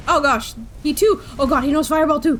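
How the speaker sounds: panicked shout as the enemy fires back; alarmed outburst. Real, not acted. Enemy casts fireball too.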